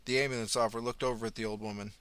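ordinary delivery, nothing marked

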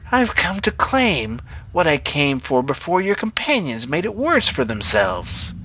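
high, swooping witch voice. A narrator's reading. Witch telling Brenner what she came to claim, high witch voice. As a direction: draw it out like a menacing witch.

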